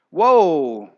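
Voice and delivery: loud, low acted "whoa"; startled realization. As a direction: exclaim it deep and loud, as if struck by it.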